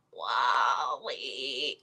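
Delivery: low, drawn-out robot name call; imitating a little movie robot; WALL-E. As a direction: stretch the name out low and slow. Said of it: "and he goes".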